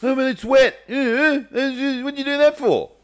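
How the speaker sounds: put-on high, loud squeaky teen voice; whiny kid voice. Son complaining about wet clothes.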